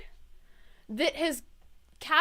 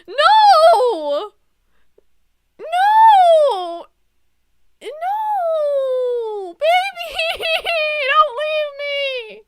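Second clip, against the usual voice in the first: high, loud, drawn-out mock crying; hammed-up wailing; 12 semitones higher, 15 dB louder, much slower than usual. Player begging Neil to stay, hammed-up sobbing wail. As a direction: wail as if melodramatically heartbroken.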